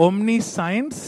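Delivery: omniscience said slowly as omni-science; memory-trick pronunciation. Shown as a demonstration. Preacher sharing a childhood memory trick, omniscience said as omni-science. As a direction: split omniscience so the word science is heard. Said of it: "That's how I use it to remember".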